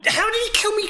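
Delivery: high startled yelp; shocked outburst. Real, not acted. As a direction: caught off guard by a death. Sudden death in game.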